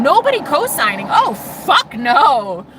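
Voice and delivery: high, loud exclamation, drawn out at the end; emphatic outburst. Real, not acted. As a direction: cry out an emphatic, stretched refusal. Emphatic outburst about no support.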